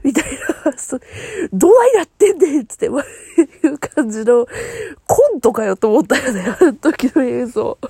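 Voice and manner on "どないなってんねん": mock exasperation. Retold exasperated line.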